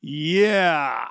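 ordinary talk, nothing marked

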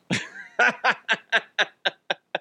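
high, staccato run of laughter; long laugh. Real, not acted. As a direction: laugh in a long rattling string. Laughs after cheering the team.

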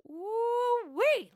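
high, loud whoop; excited whoop. Acted. Host whoops to open the quote segment, excited.

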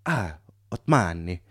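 put-on slow, comic Italian accent. Italian driver repeating a new name, slow Italian accent. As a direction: savour the name like a cartoon Italian. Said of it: "the comically stereotypical accent".

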